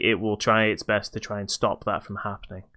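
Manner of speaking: normal talking voice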